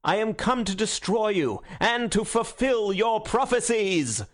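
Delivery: high, ringing denunciation in mounting phrases; thundering speech. A narrator's reading. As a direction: declaim pitilessly to a roused crowd. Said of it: "speaking in a peal of pitiless thunder".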